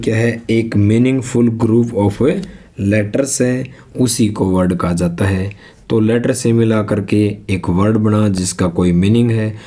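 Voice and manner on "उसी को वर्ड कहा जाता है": normal talking voice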